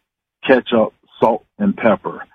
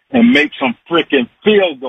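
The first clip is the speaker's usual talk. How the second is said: worked up; 9 semitones higher than usual. Real, not acted. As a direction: get worked up over the team's kicking. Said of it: "You get too worked up".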